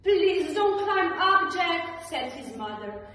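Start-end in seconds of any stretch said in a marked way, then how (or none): 0.0-2.0 pleading. A narrator's reading. Mother pleading with Jack.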